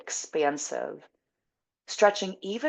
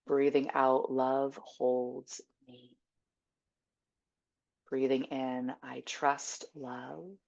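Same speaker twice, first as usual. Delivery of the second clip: guided meditation; slower than usual. Acted. Teacher giving silent mantras for each breath, slow meditation voice. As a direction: breathe each affirmation out slowly with long pauses.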